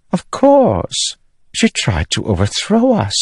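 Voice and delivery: high, cool regal voice; a queen looking down on everyone; high, icy queen dismissing a traitor; haughty queen. Acted. Queen answers about execution.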